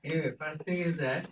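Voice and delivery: normal talking voice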